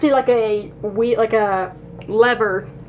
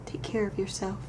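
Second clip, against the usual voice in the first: soft whisper to a toy; 18 dB quieter than usual. Acted. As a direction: whisper tenderly to the bear. Saying goodbye to toy bear.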